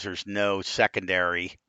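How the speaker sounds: ordinary delivery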